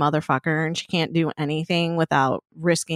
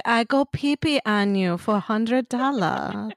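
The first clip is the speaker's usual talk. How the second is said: slow mock foreign accent, broken English; Melania; 2 semitones higher than usual. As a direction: drag it out in a parody accent. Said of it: "She would be like".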